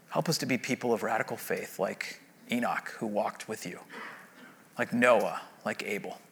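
low, hushed pastoral prayer voice; closing prayer. Acted.

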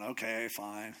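low, soft, grudging agreement; resigned assent. Acted. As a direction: concede as if humoring him. God gives in to repeated test.

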